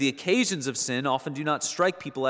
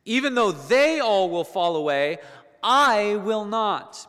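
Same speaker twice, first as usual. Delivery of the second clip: much higher and louder, very slow on I will not; emphatic vow; 8 semitones higher, 7 dB louder, slower than usual. Retold or imitated. Preacher retelling Peter's vow to Jesus, loud emphatic insistence. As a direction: insist hard, drawing out the refusal. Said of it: "Peter says".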